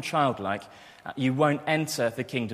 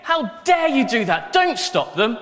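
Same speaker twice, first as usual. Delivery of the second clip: furious shout, much higher and louder; Jesus rebuking his disciples, an indignant shout; 12 semitones higher, 6 dB louder, slower than usual. Acted. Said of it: "So I think it was probably a bit more like this".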